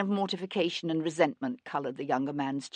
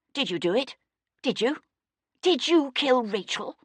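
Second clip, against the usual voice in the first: angry; 8 semitones higher than usual. Acted. Bayard demanding in fury.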